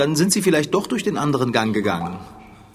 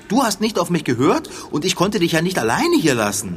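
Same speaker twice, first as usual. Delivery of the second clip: usual speaking voice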